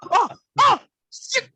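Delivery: high, loud, cracking fanboy shout; starstruck excited yell. Retold or imitated. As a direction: squeal it out like a starstruck fan meeting his idol. Friend's starstruck reaction retold.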